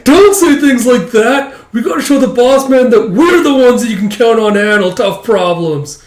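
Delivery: high, loud mock protest; rally the friend with hammed-up fervour; impassioned scolding. Flunky scolding his doubting friend, impassioned mock outburst.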